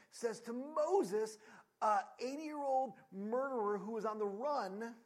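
loud, slow, rising preaching; preaching build. Acted. Preacher building the Pharaoh and Moses story, loud and slow. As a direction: build it slowly to the climax. Dramatic build in scripture retelling.

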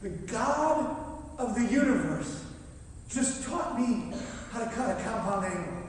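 slow, loud, emphatic proclamation; retold yell. Professor yells to his wife from the ladder, acted proclamation. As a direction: shout it out in amazement, word by word. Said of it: "he says, he yells this".

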